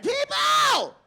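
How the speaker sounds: high rock-star yell of people, held long. Retold or imitated. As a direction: belt it like a stadium frontman. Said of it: "That's my [name]".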